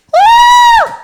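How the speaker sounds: loud high-pitched whoop; shouted woo. Acted. Comedian acting out her pent-up frustration, high shouted woo. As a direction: let out a piercing, drawn-out "woo!". Acting out an angry, pissed-off body part.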